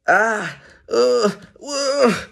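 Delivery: high, loud sickly moaning. Acted. Zebra stuffed with beetroot, moaning. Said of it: "moaned Zebra".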